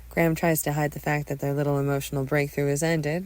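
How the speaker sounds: plain reading voice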